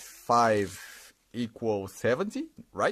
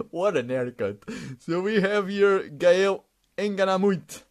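ordinary delivery

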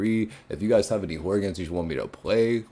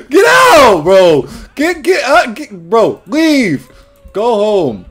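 screaming high and loud at the intruder to leave; terrified, shove him out with your voice; panicked yelling; 12 semitones higher, 11 dB louder, much slower than usual. Real, not acted. Player yelling at creepy intruder in store, panicked scream.

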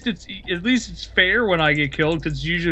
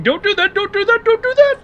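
high mock squeal, climbing; mimicking panicked warning. Player replaying a teammate's last warning, mock high squeal. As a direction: parody a friend's frantic warning. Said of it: "Player Tripwire died after hearing".

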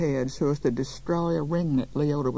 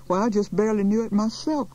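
higher, louder protest; raised, affronted voice; indignant cry; 6 semitones higher, 4 dB louder than usual. A narrator's reading. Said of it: "cried Miss Fletcher".

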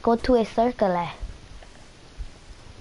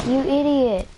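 louder, higher insult; angry yell; 5 semitones higher, 5 dB louder than usual. Real, not acted. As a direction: snap it at the enemy. Yelling at opponent.

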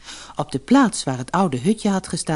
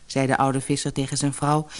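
usual speaking voice

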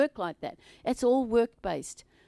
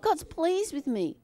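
slow affirmation; 6 semitones higher, slower than usual. Shown as a demonstration. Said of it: "Say it".

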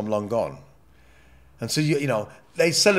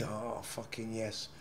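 murmured yes; 2 semitones lower, 17 dB quieter, slower than usual. Retold or imitated. Retold reply to a tea offer.

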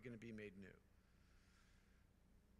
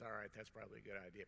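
wry Father Mike; 3 semitones lower, 4 dB quieter, faster than usual. Retold or imitated. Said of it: "He goes".

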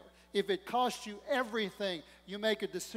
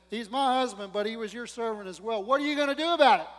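angry demand; 3 semitones higher, 8 dB louder than usual. Acted. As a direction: confront the prophet, getting louder. Widow confronting Elisha angrily.

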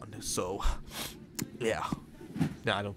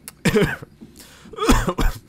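high, loud fake cough; loud mock cough; 11 semitones higher, 10 dB louder than usual. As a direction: hack out a showy cough.

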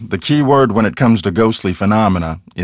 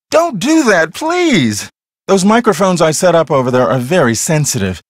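raised, higher pitched rebuke; annoyed scolding; 6 semitones higher than usual. Acted. Scolding the piano player for hurting his ears, annoyed. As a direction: tell them off for the noise.

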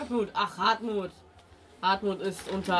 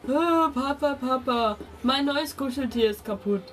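a hint of a whining little girl, higher; whiny daughter; 5 semitones higher, 3 dB louder than usual. Innkeeper mimics his whining daughter, lightly. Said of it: "Meine Tochter ist nur am Jammern deswegen".

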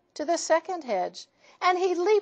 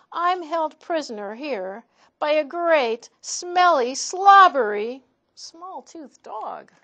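higher, louder dramatic lament; hammed-up lament; 6 semitones higher, 8 dB louder than usual. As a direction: complain of being trapped. Daughter laments captivity.

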